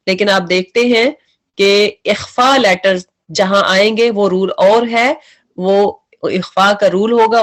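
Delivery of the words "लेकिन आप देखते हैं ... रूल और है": ordinary talk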